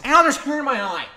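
loud, high yelp of annoyance; pained complaint. Real, not acted. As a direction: cry out at a sudden irritation. Hair gets in the eye.

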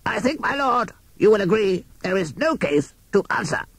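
harsh, croaking barrister crow; rough croak of a courtroom lawyer; deep rasping crow. A narrator's reading. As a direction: rasp it out like an old crow. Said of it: "Q C Crow held up his hand".

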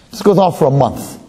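usual speaking voice

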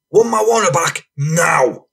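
high, slow mock-angry demand; Franny. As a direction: theatrically imitate a fuming man's demand. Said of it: "theatrically bends his back and clenches his fist".

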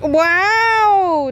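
long, high-pitched "wow"; impressed wow. Real, not acted. Praising the child's counting. (trầm trồ khen, giọng cao)

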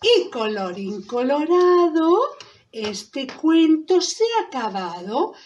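slow, rhythmic sing-song recital; story-ending rhyme. Acted. Storyteller closing the tale for children, slow sing-song. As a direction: stretch out each rhyme for children.

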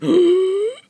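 high, loud shocked gasp; sharp gasp. Real, not acted. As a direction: gasp in dismay. Sees his bad speed.

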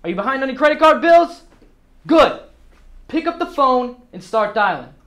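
loud, high-pitched drill with explosive one-word answers; forceful boss shouting the sales floor into action; fired-up rallying. Acted.

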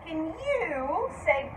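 ordinary delivery

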